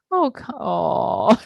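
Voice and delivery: a hammed-up little whimper; mock sob of self-judgment; mock crying. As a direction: play the self-pitying inner voice, half-crying. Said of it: "It's like".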